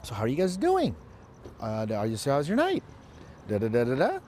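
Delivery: needy rising tune; rapport-seeking lilt. Shown as a demonstration. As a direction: ask for approval with every ending. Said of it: "where it sort of lilts up at the end".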